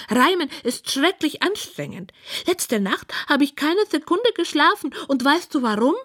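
high, put-upon pig voice; high-voiced pig lamenting; complaining pig. A narrator's reading. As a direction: moan about it in a high piggy voice. Pig complains about rhyming.

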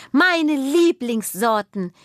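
hoch und langsam vor Freude; strahlend verkündet. Acted. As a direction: stolz und freudig ausrufen, gedehnt. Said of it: "verkündet Juna strahlend". (Kind verkündet strahlend seine Lieblingssorten)

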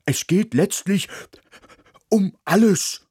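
urgent dog. Acted. Dog urges mouse.

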